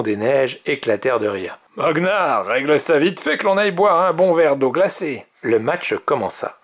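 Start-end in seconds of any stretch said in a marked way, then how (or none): 1.8-5.3 high, loud, jeering dragon; cocky teammate calling out; mocking snow dragon. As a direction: egg on your champion cockily.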